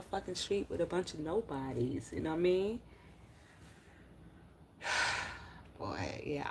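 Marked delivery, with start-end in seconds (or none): 4.8-5.5 long unvoiced weary sigh; real sigh. Real, not acted. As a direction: let out a tired breath. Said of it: "I just sighed".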